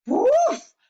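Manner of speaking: high, loud whoosh exclamation; explosive high "woof"; sudden whoosh. A narrator's reading. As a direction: burst it out like a rocket. Narrator imitates boy shooting up pipe.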